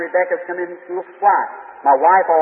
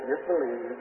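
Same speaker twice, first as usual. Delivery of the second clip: gentle hush; 6 semitones lower, 12 dB quieter, slower than usual. Retold or imitated. Jesus gently reassuring the father.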